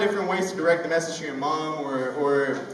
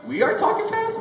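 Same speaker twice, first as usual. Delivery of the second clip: squeaky high disbelief; high-pitched mock surprise; incredulous. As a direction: act shocked at the idea. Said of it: "And I'm like".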